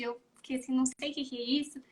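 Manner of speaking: ordinary talk, nothing marked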